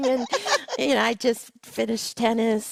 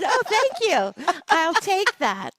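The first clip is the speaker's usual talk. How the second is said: higher, slow, hammed-up gracious; mock gracious thanks; 9 semitones higher, slower than usual. Accepting a sophisticated compliment, slow higher mock-gracious thanks. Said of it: "So I said".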